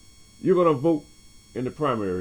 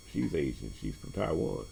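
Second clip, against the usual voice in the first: normal talking voice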